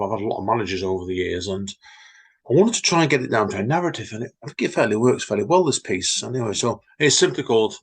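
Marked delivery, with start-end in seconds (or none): none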